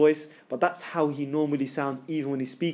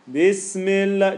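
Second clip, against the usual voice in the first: higher, loud, drawn-out "Bismillah"; recitation voice; 4 semitones higher, 5 dB louder, much slower than usual. Shown as a demonstration. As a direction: intone it slowly with full projection. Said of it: "Just say your normal voice".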